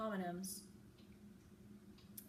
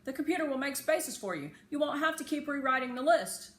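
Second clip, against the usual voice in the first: put-on raised, eager boy's voice, louder and higher; Josh's advice; 4 semitones higher, 7 dB louder than usual. A narrator's reading. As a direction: call it out like a bossy classmate. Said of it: "said to me last week".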